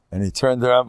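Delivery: usual speaking voice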